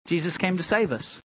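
ordinary delivery

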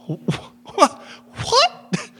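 high, squeaky shocked yelp; mock disbelief. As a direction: act stunned by the accusation. Acts shock at being accused.

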